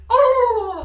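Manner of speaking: high, loud turkey gobble squawk; gobbling turkey voice. Pretend. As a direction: let rip a big turkey gobble. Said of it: "do their best turkey impression".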